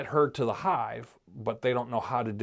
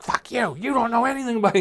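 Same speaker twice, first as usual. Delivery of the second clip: angry retort; 10 semitones higher, faster than usual. Retold or imitated. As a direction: bite back at the creepy friendly stranger. Carol snaps at the hive people.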